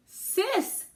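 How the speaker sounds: high, loud gasp of discovery; startled realization. A narrator's reading. Narrator realizes the postcards are to Sis. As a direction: blurt it as it hits you.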